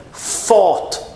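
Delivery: "fought" with a clear initial F, pitched high; F sound. Shown as a demonstration. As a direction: start "fought" on F, lip against teeth. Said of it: "Com som de F".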